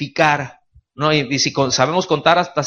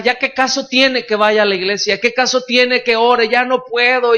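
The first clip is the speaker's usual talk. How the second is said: despairing sinner; 7 semitones higher than usual. Acted. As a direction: pour it out like someone sure God has cast them off. Church member despairing to pastor.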